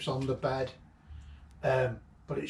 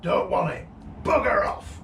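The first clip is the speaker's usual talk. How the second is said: put-on grumpy old man; 5 semitones higher, 4 dB louder than usual. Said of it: "And he went".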